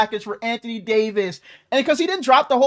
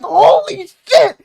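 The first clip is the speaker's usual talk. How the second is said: loud, high, slow-stretched exclamation; shocked yell; 9 semitones higher, 7 dB louder, much slower than usual. Retold or imitated. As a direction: reenact a floored, disbelieving shout. Retelling own shock, controller slammed.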